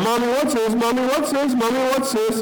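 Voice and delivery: put-on high sing-song child nagging her mom; little girl asking. Little girl in the cart nagging her mother, high child voice. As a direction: pester mommy over and over, pitched high. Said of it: "in the seat of the cart going".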